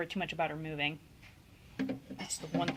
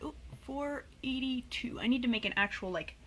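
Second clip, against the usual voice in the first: talking around a pen in teeth; marker in mouth; 7 dB quieter than usual. Real, not acted. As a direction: mumble past a marker held in the mouth. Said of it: "I'm holding the marker in my mouth".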